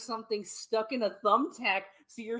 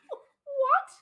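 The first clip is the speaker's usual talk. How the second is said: very high, loud, horrified doll; little daughter's toy voice; 5 dB louder than usual. Acted. Toy learning the parents will kill it, high alarmed little-girl voice. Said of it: "has the same voice as their daughter".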